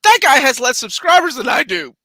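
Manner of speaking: high, loud incredulous rant; retelling scoffing at a small-channel rumor, mock indignant; mock outrage. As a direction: act out your disbelief at a rival, hammed-up. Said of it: "And I was like".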